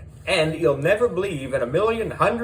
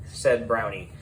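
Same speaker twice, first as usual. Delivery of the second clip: ordinary delivery, nothing marked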